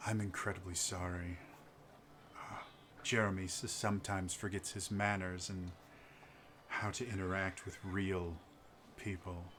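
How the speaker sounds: quiet, low, courteous apology; smooth a diplomatic apology over softly; soft-spoken noble. Acted.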